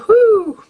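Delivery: high, loud "whoo"; quick burst of a whoo. Real, not acted. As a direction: let out a high whooping hoot. Jokey sound after theory disclaimer.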